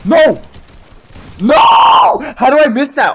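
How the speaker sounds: very high, loud screaming no; frustrated shout. Real, not acted. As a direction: scream at the missed shot. Missed shot, shouting.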